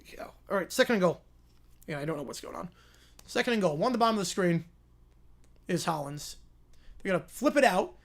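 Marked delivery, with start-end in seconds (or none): 3.3-7.9 lively TV announcer calling the drive; play-by-play call. Acted. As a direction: call the game like a network broadcaster.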